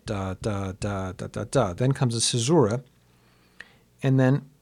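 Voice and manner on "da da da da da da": three long "da"s, two short, one long; rhythm demonstration. Shown as a demonstration. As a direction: beat out two longs and a choriamb on "da". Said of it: "followed by the choriamb".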